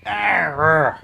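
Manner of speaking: short put-on growl; mock growl. Real, not acted. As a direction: growl back at the cat. Cat being picked up.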